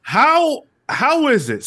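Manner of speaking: high, worked-up challenge; incredulous. Real, not acted. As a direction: demand an answer, outraged. Indignant challenge over Storm plot.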